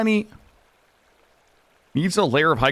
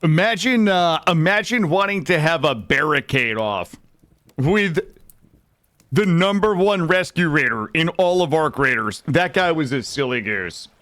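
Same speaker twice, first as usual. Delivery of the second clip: louder, bragging about being number one; loud brag; 6 dB louder than usual. Real, not acted. Streamer brags to chat about the barricade win, louder. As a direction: boast it to chat, loud and proud.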